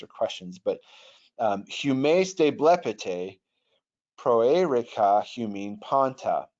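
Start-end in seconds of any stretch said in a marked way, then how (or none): 1.7-6.5 reads the Greek slowly, word by word; slow Greek reading. Shown as a demonstration. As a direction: spell out the Greek line slowly. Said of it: "Left to right".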